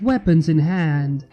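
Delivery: plain reading voice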